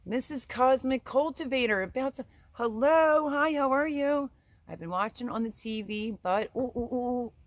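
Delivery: high, loud gushing hello; light up greeting a dear friend; excited greeting. Real, not acted. Host greeting a favourite viewer on a live stream, excited.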